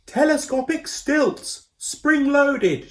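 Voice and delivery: high, slow, loud proud call; shouting proudly. A narrator's reading. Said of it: "shouted Koo".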